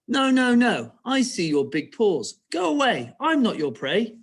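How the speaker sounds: high-pitched piggy protest; piggy's reply. Acted. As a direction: cry it out as the little pig. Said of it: "how did Piggy say it?".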